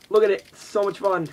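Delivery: loud, high burst of glee; excited. Real, not acted. Showing viewers the toy, with a loud gleeful burst. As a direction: shove it at the camera gleefully.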